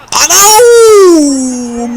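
loud high shocked yell of disbelief; a real shout at a goal nobody expected; shocked cry. Real, not acted. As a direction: cry out in stunned amazement.

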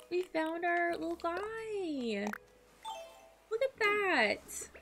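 high, slow, drawn-out delight; slow high-pitched cooing; gushing coo. Real, not acted. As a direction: draw it out adoringly, pitched high. Gushing over found villager.

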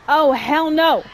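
ordinary delivery

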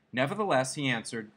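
ordinary delivery, nothing marked

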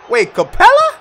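surprised. Real, not acted. Gamer confused by a foul-out, surprised. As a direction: blurt it out in disbelief.

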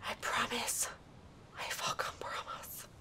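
barely audible breathy whisper. Real, not acted. Gamer promising self after outburst, faint whisper.

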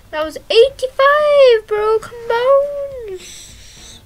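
high, loud and slow proclamation; thrilled, drawn-out score call; excited announcing. Real, not acted. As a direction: stretch it out like a game announcer.

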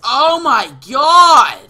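slow, loud exasperated yell; drawn-out yell. Real, not acted. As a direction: stretch it into a yell. Gamer rage over an enemy.

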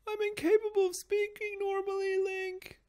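very high, whiny princess voice; Zelda. Retold or imitated. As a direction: whine every word like a spoiled princess. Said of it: "Fucking Zelda and her whiny ass voice".

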